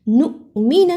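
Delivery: نؤمن with a small glottal stop on the hamza; glottal catch. Shown as a demonstration. Teacher shows students the hamza, small glottal catch. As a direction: give the ؤ of نؤمن a light catch. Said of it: "چھوٹا سا جھٹکا لگایا".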